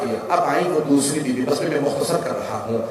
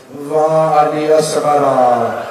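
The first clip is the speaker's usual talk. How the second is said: usual speaking voice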